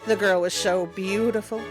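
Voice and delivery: ordinary delivery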